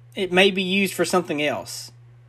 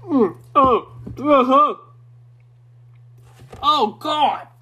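burning throat; 9 semitones higher, 4 dB louder, slower than usual. Real, not acted. First sip of strong moonshine.

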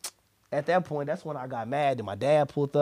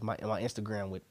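usual speaking voice

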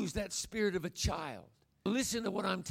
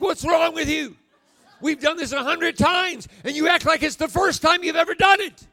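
higher, loud worn-out outburst; exasperated shout; 7 semitones higher, 10 dB louder than usual. Retold or imitated. Grandfather worn out by grandson, mock exasperated shout. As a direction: blow up at a tireless kid in mock exasperation.

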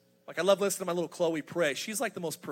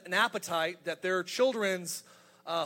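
ordinary delivery

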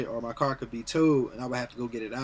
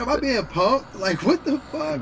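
stunned disbelief; 9 semitones higher, 4 dB louder, slower than usual. Retold or imitated. Retold reaction to the notice.

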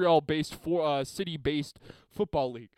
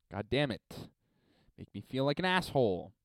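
normal talking voice

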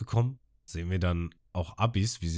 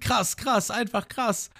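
begeistert; much slower than usual. Retold or imitated.

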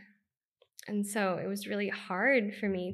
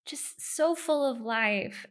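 fond laugh; 6 semitones higher than usual. Real, not acted. Fond laugh about inner child.

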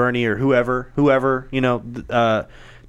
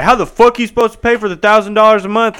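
loud, higher mock disbelief at the price tag; mock outraged skeptic; 8 semitones higher, 6 dB louder than usual. Mocking people who doubt the free money, loud and incredulous. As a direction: sneer it like a doubter asking who pays. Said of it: "People were like".

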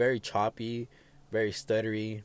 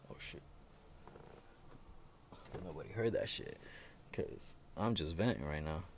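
hushed; 5 semitones lower, 14 dB quieter than usual. Real, not acted. Hushes after cursing his job.

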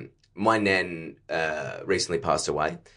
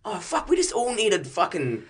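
excited realization; sudden realization; 9 semitones higher than usual. Retold or imitated. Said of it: "I was like".

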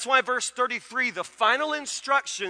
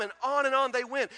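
ordinary talk

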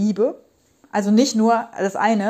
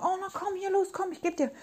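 much higher and much softer, cooing; sugary coaxing helper; 10 semitones higher, 9 dB quieter than usual. Retold or imitated. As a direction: coax like a self-sacrificing caregiver offering everything. Child voicing over-caring helper. (hoch und leise, übertrieben fürsorglich lockend)

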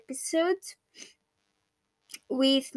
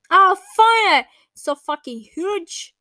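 loud exasperated cry; frustrated outburst; 6 semitones higher, 10 dB louder, slower than usual. Real, not acted. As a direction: blurt it out at the huge pile. Frustrated at too many files.

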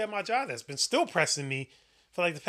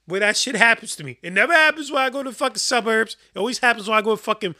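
loud, high, fired-up complaining; venting hard about the neighborhood; heated rant; 3 semitones higher, 8 dB louder than usual. Real, not acted. Ranting loudly to his co-host about getting robbed. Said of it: "He's letting off some steam right now".

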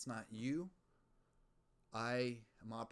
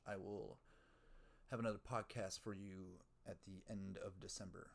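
ordinary talk, nothing marked

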